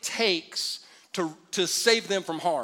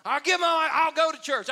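exasperated surrender; 8 semitones higher, 5 dB louder than usual. Retold or imitated. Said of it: "you're like".

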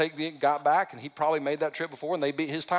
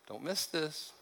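hushed repeat; 10 dB quieter, slower than usual. Shown as a demonstration. Pastor repeats his warning to the congregation, softly. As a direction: lean in and repeat it under your breath.

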